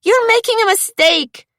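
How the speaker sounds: high, loud frightened cry. Acted. Sophia cries out to the thugs, high frightened cry. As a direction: cry out desperately. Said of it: "Sophia cried".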